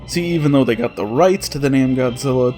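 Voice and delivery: ordinary talk, nothing marked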